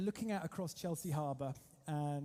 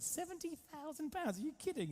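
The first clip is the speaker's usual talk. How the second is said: much higher, squeaky outraged disbelief; shocked disbelief; 11 semitones higher than usual. Retold or imitated. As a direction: gasp out the price in shock. Shocked at the price.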